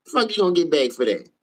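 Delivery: ordinary delivery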